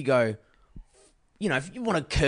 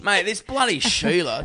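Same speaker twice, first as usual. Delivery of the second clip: hammy ocker voice, laughing; broad Aussie accent; 3 semitones higher than usual. Pretend. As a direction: lay on the Aussie bloke act. Hammed-up Aussie slang.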